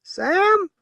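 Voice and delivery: very high, loud shriek of a name; shrill it out across the porch; shrill call. A narrator's reading. Blind woman shrilling for Sam. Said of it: "she shrilled".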